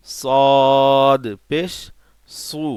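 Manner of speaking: সদ পেশ stretched and called out loudly; loud slow recitation of সদ পেশ; loud drawn-out drill. Shown as a demonstration. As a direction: boom out সদ পেশ slowly. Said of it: "রাল এটা".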